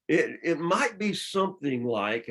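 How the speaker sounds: it, it might be, press on might; stress on might. Real, not acted. Preacher hedges to congregation, stressing might. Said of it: "I stress the word might".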